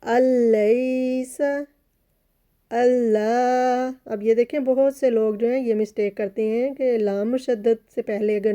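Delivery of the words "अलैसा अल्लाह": अलैसा with no नून sound, the लाम said quickly and clearly; slow tajweed recitation; quick clear lam. Shown as a demonstration. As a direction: chant slowly, merging straight into लाम. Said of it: "मैं दोबारा बता देती हूँ".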